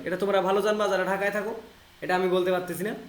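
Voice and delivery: ordinary talk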